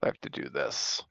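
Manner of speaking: whispered aside. Real, not acted. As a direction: breathe it to himself. Muttering to himself.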